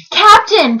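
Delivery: high, urgent plea; pleading. A narrator's reading. Bartholomew begging the captain to see danger, pleading. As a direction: plead with him to listen. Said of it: "pleaded Bartholomew".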